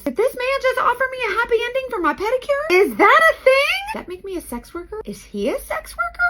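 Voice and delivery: high, rising, baffled questions; hammed-up panicked inner monologue; panicked disbelief. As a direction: spiral into shocked confusion.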